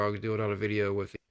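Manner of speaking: ordinary talk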